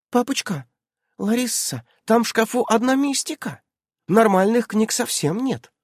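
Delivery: put-on high-pitched child's voice; high girlish voice, puzzled; little girl. A narrator's reading. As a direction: report back as a complaining child. Little girl returns, complains to adults.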